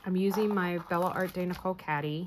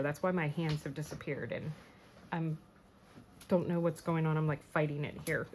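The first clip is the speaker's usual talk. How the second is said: muffled, talking through sweater fabric; words half-muffled while pulling on a sweater; 6 dB quieter than usual. Real, not acted. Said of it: "I'm putting on my sweater".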